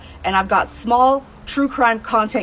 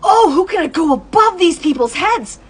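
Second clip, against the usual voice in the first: frustrated inner thought; 7 semitones higher, 6 dB louder than usual. Retold or imitated. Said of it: "And I'm thinking".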